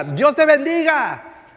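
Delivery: high, loud, drawn-out shout. Retold or imitated. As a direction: holler a blessing across the store. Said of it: "me grita".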